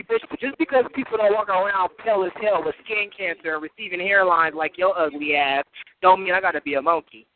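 normal talking voice

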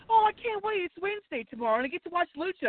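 high, giddy anticipation; eager mom. Retold or imitated. Son imitates mom gushing before bed, mock giddy excitement. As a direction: gush about tomorrow's show. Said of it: "she's like".

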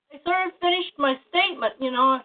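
ordinary delivery

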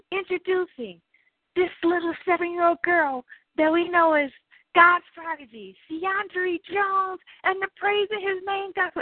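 high booming stage announcer. Retold or imitated. Festival announcer introducing the child choir, high announcer voice. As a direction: announce her like a big act. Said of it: "they're like".

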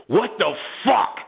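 rising to a high, exasperated mock yell; losing it. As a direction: blow up and send her away. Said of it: "I just lost it".

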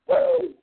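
high wrestling-promo woo, yelped out; Ric Flair woo. Retold or imitated. Host imitating Ric Flair in a wrestling promo, piercing high woo. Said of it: "pay the price of a Ric Flair".

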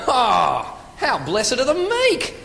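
slow, high Aussie exclamation climbing to "meek"; wrong inflection. Acted. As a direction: marvel at it like a rhetorical question. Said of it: "reading his scriptures with the wrong inflection".

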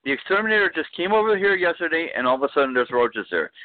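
high, indignant ranting voice; conspiracy rant voice. Acted. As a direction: rant it like a paranoid tenant. Ranting about exterminator.

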